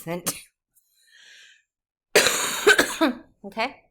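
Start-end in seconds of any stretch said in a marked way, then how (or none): 2.1-3.2 sharp unvoiced cough; hard cough. Real, not acted. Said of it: "I'm coughing so much".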